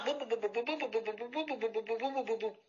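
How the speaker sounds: soft babbling bou bou; soft babbling grumble noise; mock grumble. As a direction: mimic her endless grumbling. Imitates endless grumbling.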